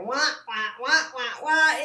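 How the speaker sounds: teasing wah-wah noise; droning wah wah. Retold or imitated. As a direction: imitate a nagging drone, lightly. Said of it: "droning on and on".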